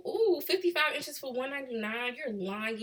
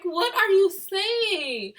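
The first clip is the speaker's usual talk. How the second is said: high, loud, slow mock disbelief; hammed-up confused exclamation; exaggerated bafflement; 10 semitones higher, 9 dB louder, much slower than usual. Mock bafflement at Baltimore accent.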